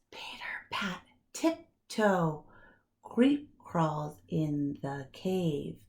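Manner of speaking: breathy tiptoe whisper; hushed pitter pat. A narrator's reading. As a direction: whisper it like tiny creeping feet. Tiptoeing mouse narration.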